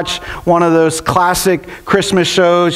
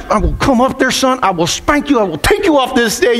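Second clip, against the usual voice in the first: mildly angry parent's threat, slightly raised pitch; retold angry threat; 4 semitones higher than usual. Dad threatening his misbehaving son onstage, retold frustrated warning. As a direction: act out a frustrated dad's warning. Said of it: "I was so angry and so frustrated".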